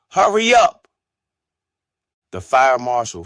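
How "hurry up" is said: very high, loud shouted order; impatient yell. A narrator's reading. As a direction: shout it sharply at top pitch. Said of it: "she yelled".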